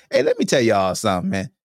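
ordinary talk, nothing marked

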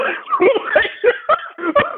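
long high laugh; high laughing fit. Real, not acted. Laughing at the memory.

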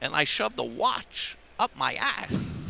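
halting, drawn-out actor impression; Christopher Walken. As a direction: imitate the actor with odd, stretched phrasing. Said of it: "trying to do Christopher Walken".

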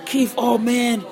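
high-pitched retold cry of dismay; imitated tearful protest; crying in disbelief. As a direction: plead with a dear friend, pitch way up. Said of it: "Oh, I'm crying".

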